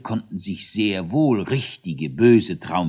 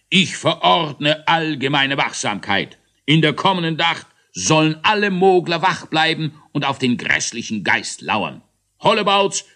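commanding order; 6 semitones higher, 5 dB louder than usual. Acted. Großmogler ordering all Moglers to keep watch, loud. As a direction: proclaim it like a pompous ruler. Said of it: "reckte er sich und befahl".

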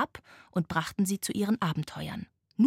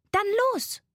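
put-on very high, eager girl's call; rally your friend to set off; 14 semitones higher, 3 dB louder than usual. A narrator's reading. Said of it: "rief Laila". (hell und unternehmungslustig gerufen)

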